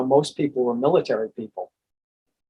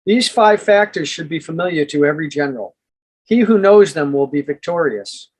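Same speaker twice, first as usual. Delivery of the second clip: measured, emphatic audiobook narration; formal book reading; 2 semitones higher, 4 dB louder than usual. Acted. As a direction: read it out like a solemn treatise, pausing between phrases. Reads book chapter aloud.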